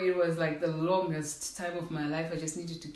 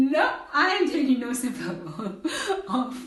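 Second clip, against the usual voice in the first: laughing refusal; 5 semitones higher, 4 dB louder, slower than usual. Retold or imitated. Refusing to remove seatbelt.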